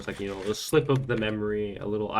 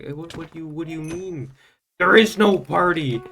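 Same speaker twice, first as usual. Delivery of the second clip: confused disbelief; 7 semitones higher, 7 dB louder than usual. Retold or imitated. Confused reply to friend.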